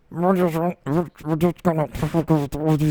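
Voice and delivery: ventriloquist voice, lips not moving; talking with mouth closed. Shown as a demonstration. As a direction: talk without moving your lips. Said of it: "that was impressive, bro. I don't even know how you were doing that. Ventriloquist".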